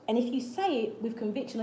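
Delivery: ordinary talk, nothing marked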